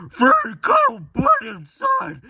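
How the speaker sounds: very high, loud squeal; shouted box slogan. Acted. Cereal box announcing a free toy, screechy falsetto. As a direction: screech it like a manic toy advert. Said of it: "but the box said".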